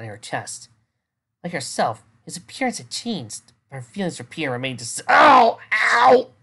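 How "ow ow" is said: sharp, high yelps of pain. Real, not acted. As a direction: yelp out in pain. Reading glitch, shouting ow.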